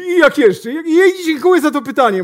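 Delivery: "I jak jeszcze?" said high and loud as a hammed-up salesman; mock bellowed repeat of "I jak jeszcze?". Salesman echoes the question loudly.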